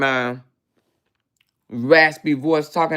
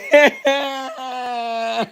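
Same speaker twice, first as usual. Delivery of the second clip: high shrieking laugh; 6 semitones higher than usual. Real, not acted. Laughing at the mad rival, high shrieking cackle. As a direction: let a high laugh burst out.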